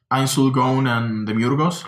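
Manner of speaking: usual speaking voice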